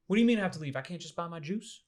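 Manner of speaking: high, loud outraged question; indignant disbelief. Retold or imitated. Customer refused service, asking in loud, high disbelief. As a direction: play the customer who can't believe he's thrown out.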